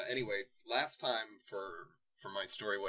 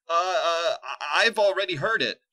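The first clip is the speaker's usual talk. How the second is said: high, loud stammering fright; trembling voice; 7 semitones higher, 9 dB louder than usual. Acted. Nova interrupts the villain, trembling and high. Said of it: "her voice trembled".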